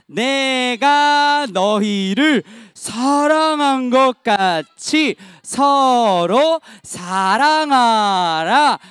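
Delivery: very slow, drawn-out verse reading; measured chant-like Bible reading; loud group recitation. Real, not acted. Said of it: "큰 목소리로 우리 같이 읽고".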